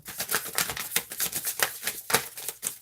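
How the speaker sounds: long, soft, voiceless laugh; real laughter. Real, not acted. Streamer amused mid-reading, helpless soft laughter. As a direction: wheeze out a quiet laugh.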